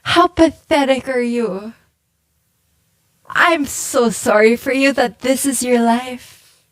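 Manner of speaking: ordinary talk, nothing marked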